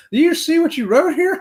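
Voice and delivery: high, loud mock wife voice; wife teasing. Wife mocks his written answers.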